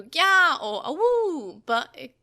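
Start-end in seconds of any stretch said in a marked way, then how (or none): 0.0-0.6 high loud animal cry; animal roar. A narrator's reading. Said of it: "animal roar similar to". 0.8-1.6 high howling call; animal howl. A narrator's reading. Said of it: "animal roar similar to".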